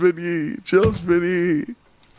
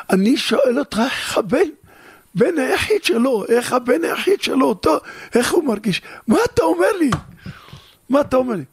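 higher, pressing questions that peak in outrage; father challenging the doctor about his only son; indignant father; 8 semitones higher than usual. Acted. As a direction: demand answers, rising into disbelief.

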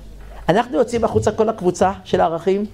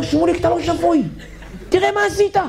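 exasperated scold; 7 semitones higher than usual. Retold or imitated. Scolding friend, laughing.

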